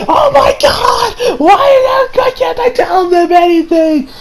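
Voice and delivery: very high, slow, drawn-out wail; anguished cry. Retold or imitated. Retelling Koichi's frustration, a very high despairing wail.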